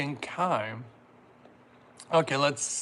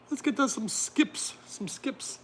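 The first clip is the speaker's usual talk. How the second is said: very high mock-British voice; playful, badly faked British; attempted British accent; 9 semitones higher, slower than usual. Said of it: "I can't do my very British accent".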